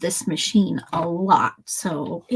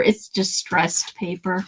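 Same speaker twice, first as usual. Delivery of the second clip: ordinary delivery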